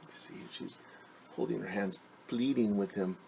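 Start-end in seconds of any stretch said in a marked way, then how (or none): none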